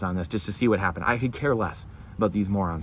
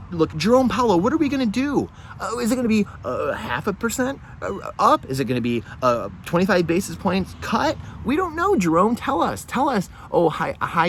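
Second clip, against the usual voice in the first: sarcastic plea; 8 semitones higher than usual. Pretend. Sarcastic plea to Fed chair.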